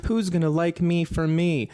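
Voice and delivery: lightly mocking me-me-me voice; whiny self-focus. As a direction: play the self-centered complainer. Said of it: "Don't just say".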